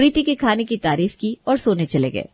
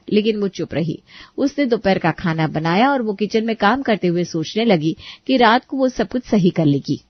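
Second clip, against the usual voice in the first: ordinary delivery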